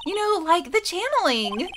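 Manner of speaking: man voicing a valley girl. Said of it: "Like, Valley Girl's good for this".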